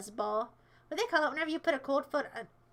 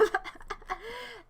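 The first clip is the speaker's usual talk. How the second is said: high, softer trailing giggles; 6 semitones higher, 8 dB quieter than usual. Real, not acted. Streamer amused by stallion joke, giggling. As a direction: break into helpless tittering.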